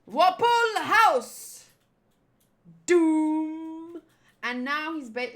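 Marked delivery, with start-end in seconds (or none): 0.1-1.7 higher, much louder, very slow cry; shouted attack name. Acted. Villain king naming his house form, drawn-out shout. 2.9-4.0 not very good doom. Acted. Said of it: "that was not a very good doom".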